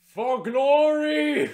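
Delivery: high, loud, drawn-out battle shout; heroic cry. Retold or imitated. Hero shouts his victory cry, high, loud and slow. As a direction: bellow it like a triumphant warrior. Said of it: "And I'm like".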